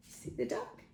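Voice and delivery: hushed whispered question. Acted. Pointing out the duck to a child, whispered. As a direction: lean in and whisper it.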